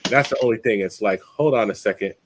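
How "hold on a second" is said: normal talking voice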